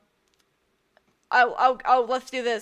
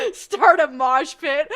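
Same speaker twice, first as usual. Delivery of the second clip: hype shout; 4 semitones higher, 11 dB louder, slower than usual. Pretend. Joking hype to chat.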